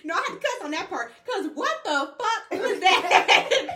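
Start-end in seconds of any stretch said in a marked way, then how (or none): 1.3-3.7 laughing disbelief. Real, not acted. Laughing about ugly dream.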